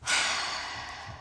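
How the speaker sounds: long, soft, overwhelmed sigh. Real, not acted. Overwhelmed by the gift.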